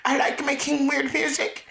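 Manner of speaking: very high, drawn-out singer parody. Acted. Comic echoing the friend's joke, high-pitched impression. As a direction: imitate the singer in a squeaky high voice. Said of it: "It's like my best [name] impression".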